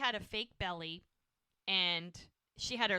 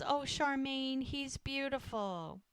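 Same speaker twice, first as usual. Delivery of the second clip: high, slow cooing admiration; tender awe; 6 semitones higher, slower than usual. Retold or imitated. Midwife showing a new mother her baby, imitated tender awe.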